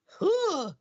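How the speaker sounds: a very high surprised grunt; passenger's puzzled huh. Retold or imitated. His passenger reacting to his gesture, high puzzled huh. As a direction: pop out a high, baffled "huh". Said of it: "She looks at me like".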